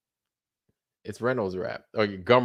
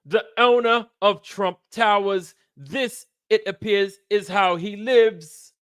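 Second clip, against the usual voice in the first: high, showy British TV host; Robin Leach; 8 semitones higher than usual. Acted. Host shows off a rich man's home, high showy announcing. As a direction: present it in a hammed-up high announcer voice. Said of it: "Lifestyles of the Rich and Famous".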